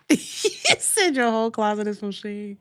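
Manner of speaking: amused, laugh breaking into the words; laughing as you tease. Real, not acted. Laughing while reading to viewers.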